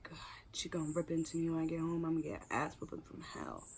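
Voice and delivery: hushed, unvoiced whisper of dread; whispered inner thought. Teen in the back seat dreading her mom, whispering. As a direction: whisper it to yourself, scared of getting caught. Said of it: "I'm like, whispered thought".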